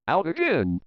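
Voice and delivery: ordinary delivery, nothing marked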